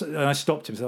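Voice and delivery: usual speaking voice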